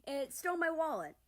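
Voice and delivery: high, loud playful yelling; mock chase shout. America yelling after the wallet thief.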